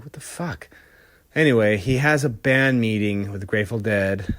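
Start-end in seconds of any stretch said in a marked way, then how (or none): 0.0-0.7 stunned mutter. Retold or imitated. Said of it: "He's like".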